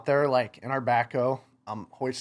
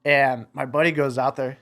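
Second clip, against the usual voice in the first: normal talking voice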